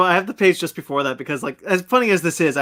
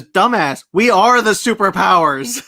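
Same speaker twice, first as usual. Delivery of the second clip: mock shout; 4 semitones higher, 8 dB louder, slower than usual. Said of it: "where he goes".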